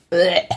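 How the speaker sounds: short disgusted gag; dry heaving. Real, not acted. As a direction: gag at the yucky slime. Disgusted by pouring slime.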